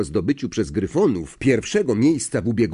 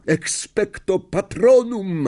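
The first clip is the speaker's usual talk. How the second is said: slow, much higher roared incantation; roaring the spell; 9 semitones higher, 4 dB louder, slower than usual. Acted. Harry faces the Dementor, roaring the spell. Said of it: "ryknął Harry".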